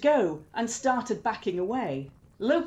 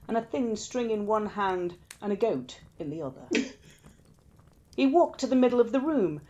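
usual speaking voice

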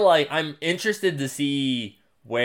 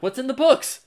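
baffled curiosity; 13 semitones higher than usual. Retold or imitated. Fan wondering about the books, high puzzled voice. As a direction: ask it like you can't believe it. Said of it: "So now I'm like".

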